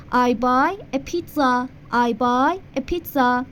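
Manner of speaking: slow teacher demo of the sentence; slow model sentence. Shown as a demonstration. Teacher modelling English for Persian students, slow. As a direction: say it slowly for repetition.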